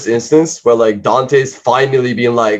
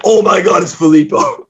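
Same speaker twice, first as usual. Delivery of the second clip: mock shock; 8 semitones higher than usual. Acted shocked recognition.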